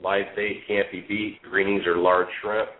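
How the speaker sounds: ordinary talk, nothing marked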